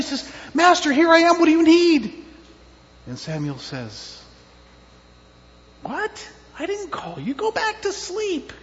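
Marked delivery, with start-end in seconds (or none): 0.5-2.2 put-on high, loud, hurried servant boy; acted boy's ready reply; eager boy servant. Boy answers master, running in. 5.8-8.5 high, puzzled voice of someone woken up; acted sleepy-master protest. Woken master answers boy.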